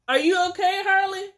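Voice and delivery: high, loud and sweet; high sweet voice to the dog. Retold or imitated. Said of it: "she'll say something. Like".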